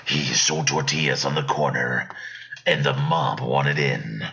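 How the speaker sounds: deep dramatic trailer read; movie trailer voice. Pretend. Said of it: "pull a little Pablo Francisco".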